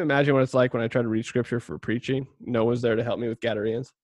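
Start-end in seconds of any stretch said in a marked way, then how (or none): none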